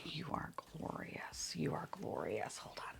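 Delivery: breathless whisper of awe; awed whisper. Real, not acted. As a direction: whisper it like something sacred. Awed by a toy.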